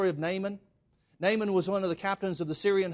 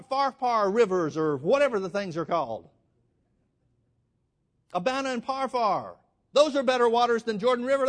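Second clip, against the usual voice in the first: much higher and louder, an offended rant; indignant outrage; 5 semitones higher, 3 dB louder than usual. Retold or imitated. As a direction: play the insulted great man, huffing. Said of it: "gets totally upset".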